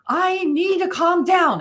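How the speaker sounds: put-on high, slow, huffy child declaring it; grumpy kid voice. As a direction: act out a sulky little boy announcing his exit. Son stomping off, voiced by mom.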